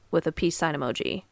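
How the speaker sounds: ordinary talk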